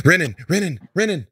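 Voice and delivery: high, calling out a name; drowsy call for her lost husband. Acted. Waking woman calling for her husband, high and searching. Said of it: "she's like".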